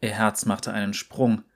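plain reading voice

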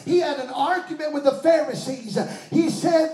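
normal talking voice

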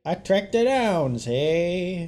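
put-on high, slow old-movie villain; fifties gangster. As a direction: drawl it like a mock fifties gangster. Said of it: "channel, like, a nineteen fifties villain".

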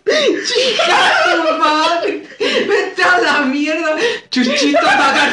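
put-on high, slow whining child voice rising to a squeak; whiny kid Jesus. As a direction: whine back like a bratty little Jesus.

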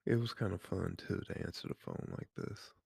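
rough just-woke-up voice; gravelly voice. Real, not acted. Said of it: "I just woke up this morning voice".

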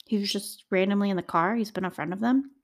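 ordinary talk, nothing marked

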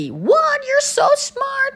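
very high, slow, gushing voice; mimicking the guy swooning over the PhD friend; smitten guy imitation. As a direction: gush it like he's instantly in love.

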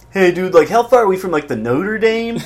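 high, loose surfer-dude voice. Retold or imitated. Riffing on the surfer character, clueless tourist surfer dude. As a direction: ham up a clueless beach bum. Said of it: "surfer voice continued".

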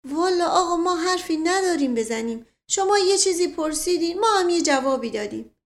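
simple servant, mildly aggrieved; defensive servant. Acted. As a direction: plead that you only answered. Mashghasem, defensive.

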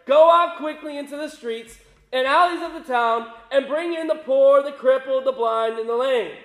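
loud, commanding reading of an angry master; forceful, loud angry command; angry order. A narrator's reading. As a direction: raise the voice and order the servant out. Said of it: "became angry and ordered his servant".

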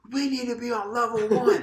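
high, full mock shout; teacher yelling. Teacher demanding level one from students, ironic yell. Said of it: "you can't yell at them".

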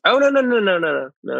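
sudden high no no no; alarmed correction; startled denial. Real, not acted. Correcting a mistake.

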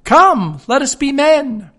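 high, loud parrot squawk. Acted. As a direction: shriek it like a caged parrot.

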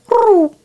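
high, loud whooshing arrow noise. Retold or imitated. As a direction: make the arrow fly past with a whoop. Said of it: "and it's like".

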